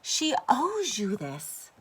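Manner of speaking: slow pushy father; demanding father. A narrator's reading. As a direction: press her, she owes you. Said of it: "the father said".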